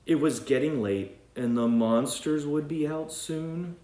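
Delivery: bright read-aloud storytelling voice; storybook narration. A narrator's reading. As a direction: read it like a bedtime book for kids. Reads story aloud to children.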